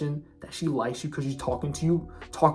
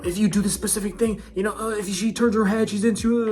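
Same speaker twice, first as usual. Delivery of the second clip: higher, louder mock voice of overthinking guys; mocking quote; 7 semitones higher, 3 dB louder than usual. Mocking other guys' dating advice in a higher, louder voice. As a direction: parody the guys who overcomplicate it. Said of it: "some guys say".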